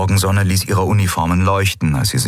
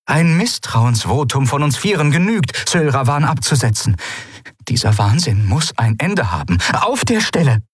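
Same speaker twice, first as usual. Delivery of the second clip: much higher admiral building to a sharp demand; determined admiral; 9 semitones higher than usual. A narrator's reading. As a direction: push it home, insistent. (entschlossene Admiralin)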